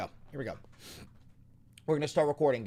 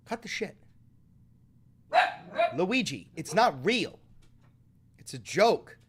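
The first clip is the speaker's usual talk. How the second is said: high, louder exasperated scold; scolding cat; 6 semitones higher, 3 dB louder than usual. Real, not acted. Scolding the cat Luigi over a fake hiss, exasperated. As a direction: tell the cat off.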